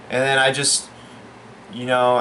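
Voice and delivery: normal talking voice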